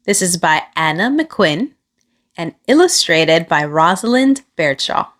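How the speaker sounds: ordinary delivery, nothing marked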